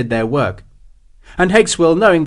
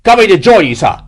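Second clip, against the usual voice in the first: high, loud reply falling away at the end; calling out; 7 semitones higher, 8 dB louder than usual. A narrator's reading. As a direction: call to your captain from hiding. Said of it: "he called".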